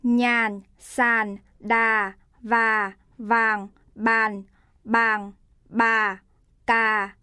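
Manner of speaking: low, falling huyền tone, said slowly and carefully; low, deliberate falling-tone drill; huyền tone demo. Shown as a demonstration. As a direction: drop each word low and let it fall, like a teacher modelling. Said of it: "Sự khác biệt của thanh sắc và huyền".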